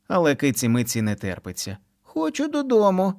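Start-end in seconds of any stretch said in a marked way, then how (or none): 2.0-3.1 put-on high whiny child cry; child shout. A narrator's reading. Kitty yells at mom to go home, high child cry. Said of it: "кричить вона".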